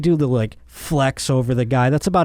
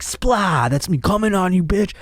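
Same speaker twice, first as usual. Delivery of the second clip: high, crowing mock trash talk; taunting linebacker; 6 semitones higher than usual. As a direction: gloat over the quarterback, pitched well up. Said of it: "like, being like".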